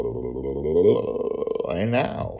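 ordinary talk, nothing marked

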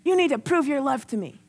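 high, accusing mock guilt-trip; mock demanding. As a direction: act out a reproachful, controlling demand for love. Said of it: "That's not what he was saying".

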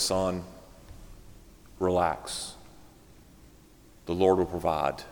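low, soft words with long pauses; quietly calm a panicking youngster; comforting. Acted. Older minister tells young preacher to relax, comforting. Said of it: "Comforting words".

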